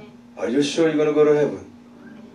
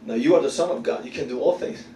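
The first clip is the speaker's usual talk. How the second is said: ordinary talk, nothing marked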